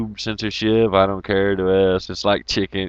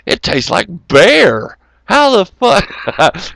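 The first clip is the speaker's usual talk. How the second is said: laughing disbelief; 7 semitones higher, 4 dB louder, slower than usual. Real, not acted.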